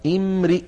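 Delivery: "امرئ" as "imri'", "i" after the r; third form, kasra. Shown as a demonstration. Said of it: "الثالثة". (نطق «امرئ» بكسر الراء)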